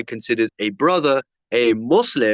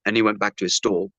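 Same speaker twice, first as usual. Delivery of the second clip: ordinary talk, nothing marked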